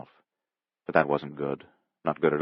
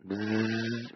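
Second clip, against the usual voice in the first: long buzzing zzz, softer; imitated bee buzz; 4 semitones higher, 9 dB quieter than usual. A narrator's reading. Boy teases his sister as a bumblebee, drawn-out buzz.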